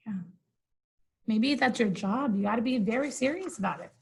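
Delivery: ordinary delivery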